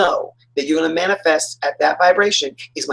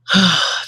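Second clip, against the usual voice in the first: big sigh of inner peace; blissful sigh. Retold or imitated. Feeling that inner peace, long blissful sigh. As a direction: let out a contented sigh. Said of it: "that peace, that inner, like".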